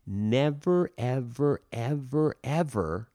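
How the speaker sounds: each word hit hard, the first one highest; stressing never, ever, ever, ever word by word; emphatic never ever. Real, not acted. Coach warning actors about excuses, emphatic stress. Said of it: "and when I say that, I mean".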